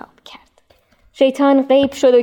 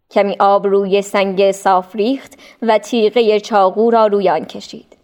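usual speaking voice

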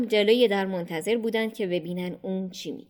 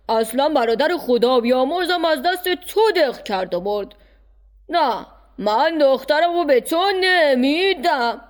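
higher, louder stern uncle refusing; old uncle flatly turning down his nephew; 4 semitones higher, 5 dB louder than usual. Acted. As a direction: scold the young suitor from above. Uncle refusing Harun's proposal.

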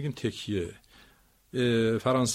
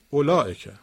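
اولائکه with لا raised and louder; stress on second syllable; 6 dB louder than usual. Shown as a demonstration. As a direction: punch the لا in اولائکه. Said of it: "کدومش رو بالاتر ببرید". (اولائکه با تکیه روی لا)

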